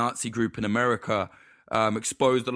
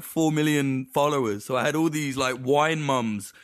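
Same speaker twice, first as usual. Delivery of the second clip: usual speaking voice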